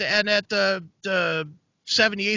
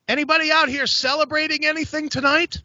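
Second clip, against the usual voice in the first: higher, projected club-host call; crowd work comic; 9 semitones higher than usual. Retold or imitated. Comedian asking the room for celebrations, crowd-work call. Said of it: "typical crowd work guy".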